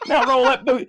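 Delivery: high, near-crying Southern voice; tearful high Southern impression; Camelot. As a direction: mimic a high-pitched Southerner on the verge of tears. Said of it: "as I'd say the voice".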